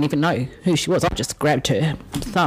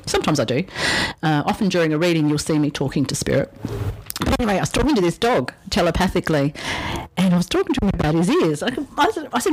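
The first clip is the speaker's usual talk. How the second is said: ordinary talk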